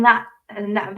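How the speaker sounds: ordinary talk, nothing marked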